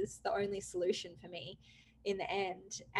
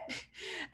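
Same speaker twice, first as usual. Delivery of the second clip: breathy shocked laugh; 11 dB quieter than usual. Real, not acted.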